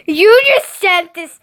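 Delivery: high, loud horrified outburst; total meltdown. A narrator's reading. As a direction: melt down, building to a yell. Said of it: "I had a total meltdown".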